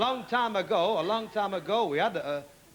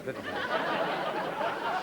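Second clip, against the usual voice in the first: laughing; 4 semitones lower, 5 dB quieter, much slower than usual. Acted. Leprechaun joke.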